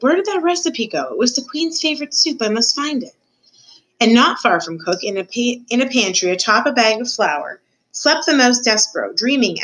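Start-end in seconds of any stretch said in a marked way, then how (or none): 0.0-3.1 lightly acted sleep-talk, pitched up; sleep-talking mumble. A narrator's reading. Cook fretting over a lost recipe in her sleep, mild sleep-talk. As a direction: fret mildly as if dreaming. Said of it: "she mumbled in her sleep".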